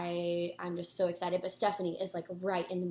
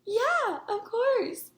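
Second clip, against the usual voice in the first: high, bright, eager agreement; cheery yes; 14 semitones higher, 4 dB louder, much slower than usual. Retold or imitated. Singer agreeing to a photo, sweet and high. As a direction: say it sweetly and much higher. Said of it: "she goes".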